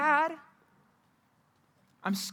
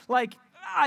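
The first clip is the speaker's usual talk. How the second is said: frustrated shout; 4 semitones higher, 4 dB louder than usual. Retold or imitated. Shows unexpressible anger.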